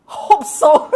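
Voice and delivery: laughing through the words. Real, not acted. A teacher laughing at the hope of transiting. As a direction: chuckle it out amused.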